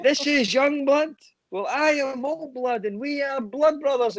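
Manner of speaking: very high, slow mock showman; hammed-up stage announcement. Imitating dad introducing himself on stage, grandly hammed-up. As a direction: imitate dad milking the crowd, stretched out high.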